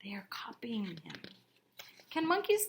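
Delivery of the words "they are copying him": soft unvoiced whisper. A narrator's reading. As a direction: let them in on a secret. Aside to children, whispered.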